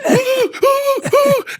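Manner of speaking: shrieking high laugh; hysterical laugh. Retold or imitated. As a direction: lose it completely. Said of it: "my Uncle Jerry's just".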